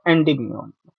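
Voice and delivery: usual speaking voice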